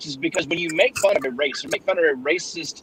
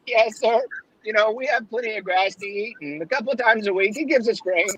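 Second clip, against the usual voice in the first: a hint of a higher goat voice; lightly raised talking goat; lightly voiced goat; 6 semitones higher than usual. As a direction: play the polite goat, a bit higher.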